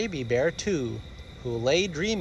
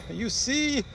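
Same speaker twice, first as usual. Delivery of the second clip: laughing; 10 semitones higher than usual. Acted. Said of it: "Daddy laughed".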